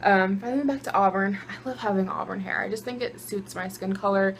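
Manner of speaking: ordinary delivery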